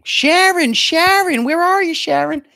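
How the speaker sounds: put-on high, loud old-lady holler across a parking lot; old woman yelling. Old woman calls for her friend in the lot, high loud holler. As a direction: shriek the name like a lost grandma. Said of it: "I hear an old woman yelling".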